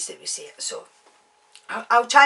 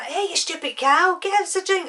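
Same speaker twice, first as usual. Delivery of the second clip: higher, louder imitation English accent; put-on English accent; 9 semitones higher, 5 dB louder than usual. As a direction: shout it up in a rough attempt at English. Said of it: "That was my God knows what accent".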